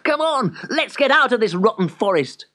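high, lively call to leave; rally your friend in high spirits; gleeful urging. A narrator's reading. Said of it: "laughed Simeon".

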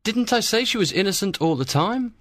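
quick, pleased insistence; mildly triumphant friar; happy. A narrator's reading. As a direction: remind them briskly that you were right. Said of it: "was very happy".

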